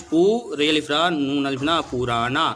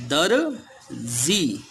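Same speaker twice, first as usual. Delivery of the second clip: slow drawn-out word; much slower than usual. Shown as a demonstration. Teacher spelling a word for children, slowly drawn out. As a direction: stretch दर्जी slowly, letting the vowels ring. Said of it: "दर्जी में किसकी आती है".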